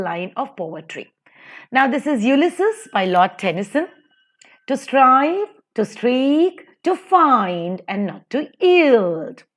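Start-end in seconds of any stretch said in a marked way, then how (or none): 4.7-9.4 light "to", stretched "strive", "find" and "yield"; measured verse beat, stress on "strive", "find", "not", "yield"; iambic stress. Shown as a demonstration. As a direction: recite "to strive" in rising iambs, landing on the second word. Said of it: "to is unstressed".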